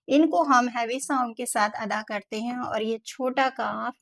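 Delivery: ordinary talk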